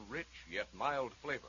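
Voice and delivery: usual speaking voice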